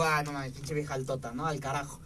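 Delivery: mild disappointed aside; muttered dismay. Retold or imitated. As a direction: grumble it to yourself. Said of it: "la veo y digo".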